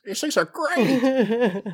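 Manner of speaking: squeaky, slow, delighted chewing voice; goofy high voice. Acted. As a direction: gush over the snack, high and slow. Hank munching chocolate crickets.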